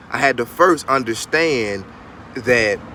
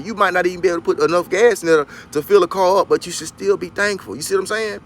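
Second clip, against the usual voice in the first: ordinary talk, nothing marked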